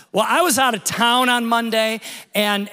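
usual speaking voice

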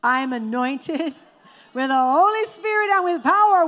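"I'm anointed with the Holy Spirit and with power" called out high and loud with a laugh; said like you really mean it. Shown as a demonstration. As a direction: proclaim it with full conviction, laughing with joy. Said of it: "Now say it like you really mean it".

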